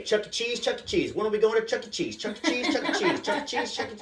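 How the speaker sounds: put-on high, relentless kid chant; excited little daughter. Daughter begs dad all day, excited child voice. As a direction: imitate a pestering child in a high voice.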